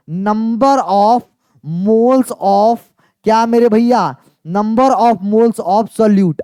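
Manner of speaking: very slow, word by word, letting students write; slow dictation. Shown as a demonstration. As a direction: spell out each word of the definition. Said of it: "teacher dictates the definition slowly". (बहुत धीमे, शब्द-शब्द बोलकर लिखवाना)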